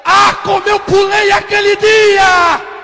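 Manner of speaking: high, loud, stretched cry of joy; real jubilant preaching shout, drawn out; exultant shout. Real, not acted. Preacher recalling how he jumped that day, exultant shout.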